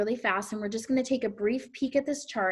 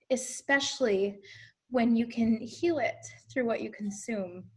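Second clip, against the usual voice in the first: ordinary delivery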